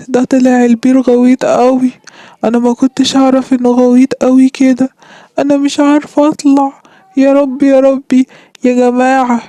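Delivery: high, frantic calls from the bottom of a well; desperate shouts up the well; panicked cry for help. Acted. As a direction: cry out for rescue, trapped and scared. Fox fell into deep well.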